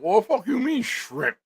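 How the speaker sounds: high-pitched retold outrage; outraged disbelief. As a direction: recoil in loud, high disbelief. Allergic man learns he ate shrimp.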